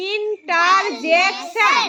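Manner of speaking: slow, emphatic ইন-টার-জেক-শন; syllable by syllable. Shown as a demonstration. Teacher summing up a four-syllable word, syllable by syllable. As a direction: hammer out interjection in four long beats. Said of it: "এটা হলো tetra syllable".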